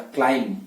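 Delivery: क्लाइम said with no b sound at the end; क्लाइम, the final b dropped; silent b in climb. Shown as a demonstration. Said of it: "इसके एंड में जो बी है उसका उच्चारण नहीं करना".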